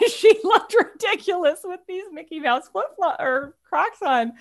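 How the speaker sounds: can't stop laughing. Real, not acted. Describing the mother-in-law's Mickey Mouse Crocs, laughing.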